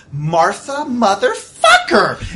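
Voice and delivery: slow, loud laughing yell; shout. Acted. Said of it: "she's like".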